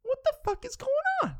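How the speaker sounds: screeching high inner panic; panicked inner voice. Shown as a demonstration. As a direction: freak out in a tiny panicked voice. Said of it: "it starts going".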